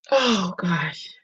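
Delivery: fighting back tears; crying. Real, not acted. Crying as she tries to compose herself for viewers. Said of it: "I'm sorry I'm crying".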